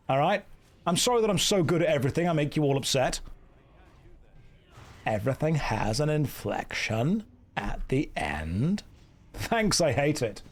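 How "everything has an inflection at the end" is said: put-on slow, lower vocal-fry Valley Girl drone; creaky Valley Girl drawl. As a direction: sound bored in a Valley Girl accent. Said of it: "We found his weakness, American Valley Girls".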